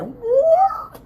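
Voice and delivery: high, sudden dog-like yelp; sharp high animal noise; startled dog. Retold or imitated. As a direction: imitate a dog whipping round. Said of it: "The dog turned around like".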